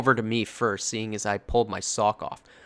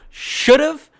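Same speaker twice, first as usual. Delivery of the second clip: loud emphasis; 12 dB louder than usual. Acted. Emphatic correction of redditor.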